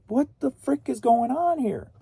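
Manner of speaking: high-pitched mock bafflement; baffled reaction. Retelling own reaction to the sales, baffled. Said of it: "I'm like".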